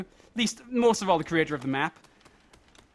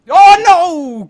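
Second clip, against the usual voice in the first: long, loud, high cry; high-pitched shout of dismay; panicked yell; 11 semitones higher, 16 dB louder than usual. Real, not acted. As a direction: scream it as you fall. Falls in game.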